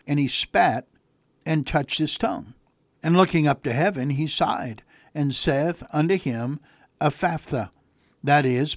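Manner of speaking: measured, soft Bible reading, slowing toward the end; scripture reading. Acted. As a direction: read the passage reverently and steadily. Pastor reads scripture aloud.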